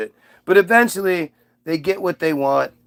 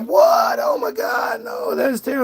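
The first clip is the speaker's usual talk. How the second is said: much higher, alarmed yelp; shocked shriek. Acted. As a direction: shriek in panic at the news. Slaves hear God threat.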